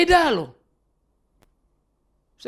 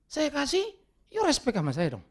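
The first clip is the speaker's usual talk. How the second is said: demanding giver; 7 semitones higher, 4 dB quieter than usual. Retold or imitated. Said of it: "Itu kan transaksi namanya".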